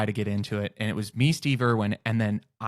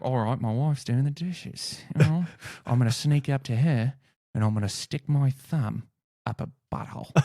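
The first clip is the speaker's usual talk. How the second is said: broad Australian wildlife commentary, hushing at the end; mock nature-show host, conspiratorial; Steve Irwin; 2 semitones higher than usual. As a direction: narrate a stealthy approach in Australian. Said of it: "I would be Steve Irwin".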